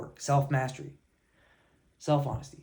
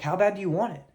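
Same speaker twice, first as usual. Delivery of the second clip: loud, higher, pushing the question; coach's challenge; 5 semitones higher, 5 dB louder than usual. Retold or imitated. Coach challenging his runners, loud and demanding. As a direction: bark it like a coach firing up runners. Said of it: "my cross-country coach used to always say".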